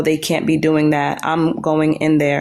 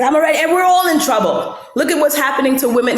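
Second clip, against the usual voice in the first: mock warning; 8 semitones higher than usual. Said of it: "She's like".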